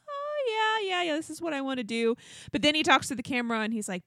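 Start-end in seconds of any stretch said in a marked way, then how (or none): 0.1-2.1 mock eager boyfriend, pitched way up and quick; eager yes-man. Contestant assuring the parents, in a high, rushed mock-eager voice. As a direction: rattle off the yeses like an overeager suitor.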